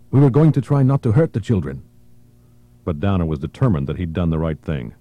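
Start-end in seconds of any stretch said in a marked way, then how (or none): 0.1-1.8 heated rebuke. Acted. Barone argues with Downer.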